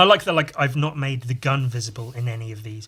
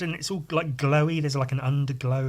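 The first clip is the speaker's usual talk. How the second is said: normal talking voice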